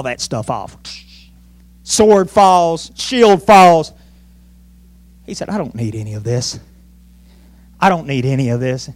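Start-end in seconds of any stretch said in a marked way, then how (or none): 5.5-8.9 low, hushed determination; quiet resolve. Acted. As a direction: say it low and soft, as resolute David. Acting David throwing off armor.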